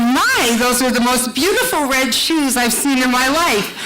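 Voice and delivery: a gushing compliment from a man on his knees; admiring Bill. Retold or imitated. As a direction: lay the flattery on thick. Bill slides over, admiring her shoes.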